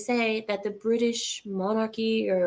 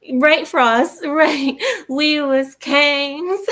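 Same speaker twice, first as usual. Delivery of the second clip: higher, louder and laughing; playful; 5 semitones higher, 6 dB louder, much slower than usual. Real, not acted. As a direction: hype it up jokingly. Joking with a chat member.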